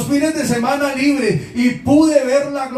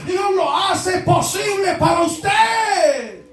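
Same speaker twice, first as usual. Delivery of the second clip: rising fervent preaching; 5 semitones higher than usual. Acted. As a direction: build it up to a ringing proclamation. Preaching rises to full force.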